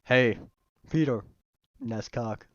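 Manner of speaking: celebrity impression, punched opening word; halting, put-on sitcom neighbour voice; Patrick Warburton. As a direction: do a deadpan actor impression with gaps between phrases. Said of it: "doing fucking Patrick Warburton".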